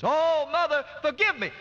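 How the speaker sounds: a daughter wailing over her mother's casket; broken cry to a dead mother; crying out through tears. Acted. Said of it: "through tears, she began to cry and say".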